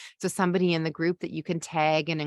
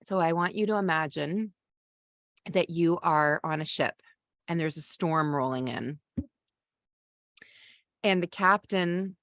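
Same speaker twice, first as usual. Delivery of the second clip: ordinary delivery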